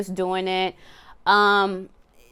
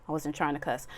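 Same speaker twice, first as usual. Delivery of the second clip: much softer, apologetic aside; sheepish aside; 5 semitones lower, 9 dB quieter, faster than usual. Real, not acted. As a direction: mutter it under your breath. Aside after cursing.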